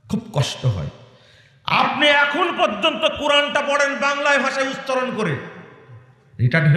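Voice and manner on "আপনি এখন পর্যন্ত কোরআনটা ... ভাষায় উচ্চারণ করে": high, indignant scolding; angry reproach. Real, not acted. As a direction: scold the listener at a high pitch. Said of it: "রাগ বেশি হয়ে গেছে". (রাগী চড়া গলায় তিরস্কার)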